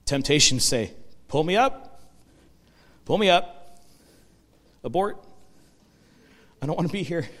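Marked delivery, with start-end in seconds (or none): 1.3-7.3 higher, pleading voice begging to be pulled up; paralyzed man's panicked plea to be lifted out, mock fear. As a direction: play the frightened man wanting out, a little hammed-up. Imagined panic of the man.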